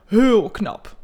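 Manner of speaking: loud, playfully exaggerated compliment; playful mock praise; joking. A narrator's reading. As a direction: tease it with a big swooping first word. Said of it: "grapt ze".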